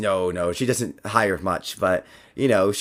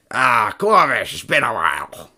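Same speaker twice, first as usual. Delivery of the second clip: bugbear bartender; 5 dB louder than usual. Acted.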